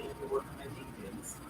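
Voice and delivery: a breathy, playful aside under the breath; teasing whisper. Real, not acted. Streamer whispering a cheeky joke to the chat, teasing whisper.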